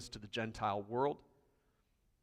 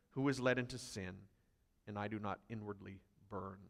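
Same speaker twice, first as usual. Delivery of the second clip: forceful scripture reading; 2 semitones higher, 5 dB louder than usual. Acted. A pastor reading Paul's sufferings to his church, building then hushed. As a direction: build the list of sufferings, then drop to a hush. Reads Paul's list of hardships.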